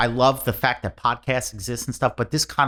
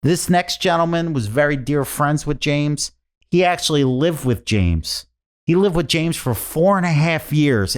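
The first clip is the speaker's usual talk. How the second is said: lightly imitated stage announcer, higher pitch; MC introduction; 7 semitones higher than usual. Comic acting out the memorial's host, MC introduction. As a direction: introduce a speaker to the room, drawn out.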